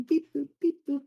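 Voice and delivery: high-pitched robot-like beep boop; imitated beeping. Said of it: "walking around going".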